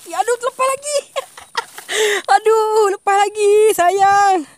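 high loud cries of loss; dismayed shout. Real, not acted. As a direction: wail as the fish gets away. Fish escapes again, dismayed. (jeritan kecewa)

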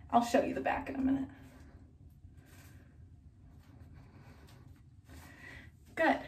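muffled behind cloth; speaking through fabric covering; through a face mask. Real, not acted. Seen 1: Tied cloth mask covers her nose and mouth throughout speech.

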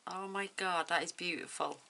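ordinary delivery

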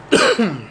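short dry cough. Real, not acted. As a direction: cough once, dry and sharp. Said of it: "Desculpa a tosse aí".

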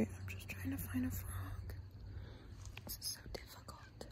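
frustrated hushed whisper. Real, not acted. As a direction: sigh it under breath. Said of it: "I gotta be quiet".

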